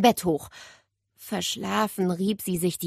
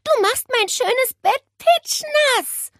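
put-on slow, higher, louder complaining girl; cross girl; 6 dB louder, slower than usual. Nele complaining about her wet bed, slow cross whine.

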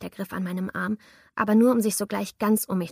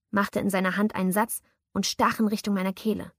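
ordinary delivery

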